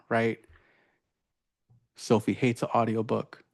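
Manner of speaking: ordinary delivery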